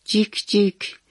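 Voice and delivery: a far-off friend chirping back; lightly voiced baby chick.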